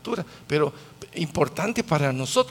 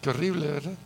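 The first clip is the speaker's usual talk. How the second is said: normal talking voice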